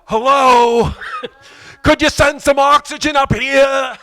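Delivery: high, loud, laughing plea; brain calling for air. Acted. His brain begging for oxygen, high, loud and laughing. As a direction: call up like a desperate brain. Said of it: "my brain is like".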